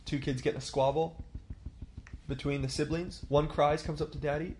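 ordinary talk, nothing marked

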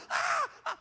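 very high frightened squeals; panicked cry. Retold or imitated. Pastor mimicking a panicking moviegoer, very high squeals. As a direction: squeal like the scene just got you. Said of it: "When you don't know what's gonna happen".

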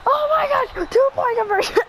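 high, throat-straining celebration; real high-pitched gamer scream; screaming excitedly. Real, not acted. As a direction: squeal it until the throat hurts. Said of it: "I'm kinda screaming".

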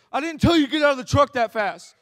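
cop scolding. Retold or imitated. Cop to driver who jumped out, angry scolding. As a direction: bark it like a rattled cop.

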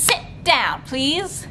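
usual speaking voice

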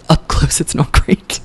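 whispering the verdict; hushed little dig; whispered aside. Real, not acted. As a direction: lean in and whisper it.

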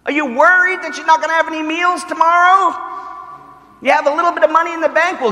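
high, loud hammed-up preacher voice; mock televangelist. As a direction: parody a prosperity preacher grabbing money. Mocking prosperity preacher.

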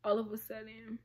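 normal talking voice

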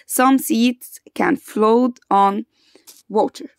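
very slow, word by word for the class; slow dictation pace. Shown as a demonstration. Teacher to grade four pupils, slowly for repeating. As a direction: spell the sentence out slowly so they can repeat. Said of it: "يبقى again".

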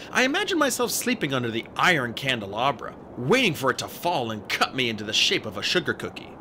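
usual speaking voice

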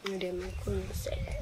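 hushed aside under the breath; whisper. Acted. Muttering an aside during the quarrel, whispered. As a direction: whisper it so the other can't hear.

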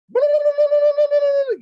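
high, loud trilled holler; Fijian yell. Retold or imitated. Old Fijian man calling up the hill, trilled yell. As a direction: let out a long, piercing trilling call. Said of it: "gives out this Fijian yell".

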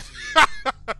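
loud, high burst of laughter; startled laughing. Real, not acted. Laughs after being startled.

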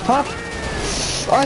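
ordinary talk, nothing marked

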